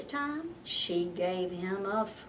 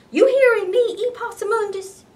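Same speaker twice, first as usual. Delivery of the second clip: much higher, loud call of a stern mother; mama calling out; 10 semitones higher, 9 dB louder than usual. Acted. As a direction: call him sharply across the yard. Mama calling her son.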